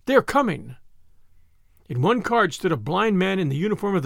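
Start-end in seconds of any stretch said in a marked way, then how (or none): 0.0-0.8 high, urgent call; urgent cry. A narrator's reading. Fleeing crowd warning those ahead, urgent high cry. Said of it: "was the cry".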